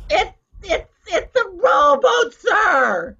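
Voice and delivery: flustered cry. Acted. Flustered tourist cries out.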